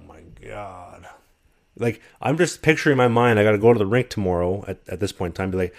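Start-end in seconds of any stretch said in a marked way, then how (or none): 0.0-1.1 very quiet, low, slow; acted dismay. Traveler finding his bags missing, hushed dismay. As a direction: breathe it out as a sinking realization.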